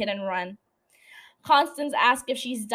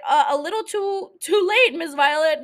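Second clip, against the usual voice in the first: mock scolding; 6 semitones higher, 6 dB louder than usual. Real, not acted. Mocking Violet directly.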